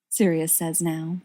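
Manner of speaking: usual speaking voice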